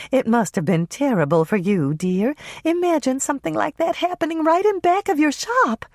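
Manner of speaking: a hint of fussy concern; Carrie, sympathetic older lady. Carrie consoling Hannah, high older lady voice.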